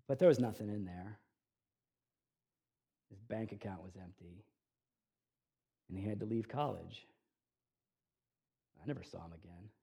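low, hushed, with long pauses; subdued, grave storytelling; sombre. Real, not acted. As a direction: let the bad outcome land quietly. Sombre end of the con story.

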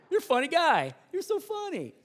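high, hammed-up "funny guy" comeback; mock amusement. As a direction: play up being tickled by an insult. Retold reply to boy's insult.

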